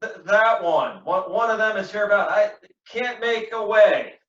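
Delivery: halting, high, pained delivery; wounded gasping. Acted. Wounded Cassio tells Iago about his attackers, stumbling and pained.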